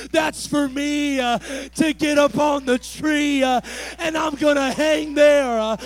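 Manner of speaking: loud, chanted preaching with huffed uh's; full-force preaching. Acted. Preacher voicing Jesus speaking to Zacchaeus, chanted full-force preaching. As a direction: build it toward the cross.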